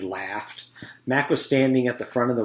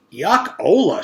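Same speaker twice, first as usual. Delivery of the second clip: a touch of a disgusted shriek, louder; mild mock shriek; 6 semitones higher, 7 dB louder, slower than usual. A narrator's reading. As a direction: squeal it in disgust. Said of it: "shrieked Allison".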